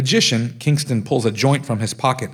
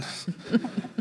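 stifled laugh; 12 semitones higher, 10 dB quieter than usual. Real, not acted. Can't contain laughter.

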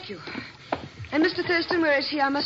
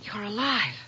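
shocked whisper. Acted. Shocked to see him alive.

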